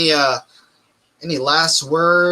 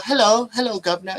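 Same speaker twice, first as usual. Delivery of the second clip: put-on son's playful British accent, pitched up; mock British accent; 7 semitones higher, faster than usual. Dad imitating his son's Mister Bean British accent. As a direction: ham up a Cockney greeting. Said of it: "goes into his Mister Bean shit".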